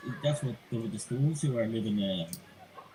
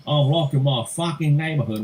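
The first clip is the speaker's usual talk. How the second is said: put-on Australian accent; 5 semitones higher, 6 dB louder than usual. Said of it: "it's Australian accent".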